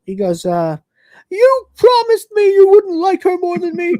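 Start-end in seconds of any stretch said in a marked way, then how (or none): 1.3-3.9 high, loud whining meltdown; shrill-pitched mock tantrum, last words dragged out; whiny voice. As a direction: wail it like a hammed-up wounded whiner. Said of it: "the whiny voice".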